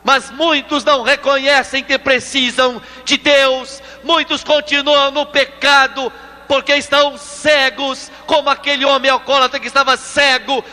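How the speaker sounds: preaching at full force. Acted. Preacher calling listeners to cry out for deliverance, fervently. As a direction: ring it out as a fiery altar call.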